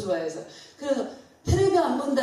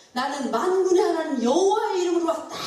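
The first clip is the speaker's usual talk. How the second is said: high, ringing preacher's proclamation; bold declaration; 6 semitones higher than usual. Retold or imitated. David answering Goliath in God's name, boldly declaring. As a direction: proclaim it as a battle challenge.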